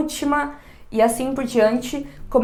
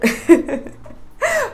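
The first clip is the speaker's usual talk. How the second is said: short laugh; 10 semitones higher than usual. Real, not acted. Laugh about joining Tinder.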